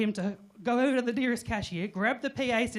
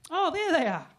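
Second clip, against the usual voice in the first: mock surprise, higher and louder; fake surprise; 10 semitones higher, 4 dB louder than usual. Acting surprised to his wife in the aisle. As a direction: pretend you just spotted them.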